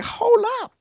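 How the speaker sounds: a high, startled stop; sudden halt. Retold or imitated. David interrupting his own lament, startled and high. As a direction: catch yourself mid-thought.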